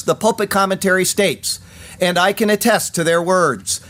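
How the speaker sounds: ordinary talk, nothing marked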